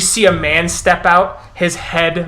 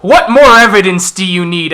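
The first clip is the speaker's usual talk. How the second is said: loud, high exclamation of proof; triumphant accusing elder; 4 semitones higher, 8 dB louder than usual. Acted. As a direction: shout it to the crowd as proof. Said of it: "as he goes".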